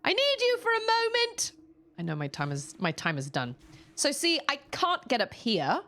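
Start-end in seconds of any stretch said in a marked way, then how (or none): 0.0-1.5 high calling voice across the house. Real, not acted. Calling her sister from her seat, raised and high. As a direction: call her from another room.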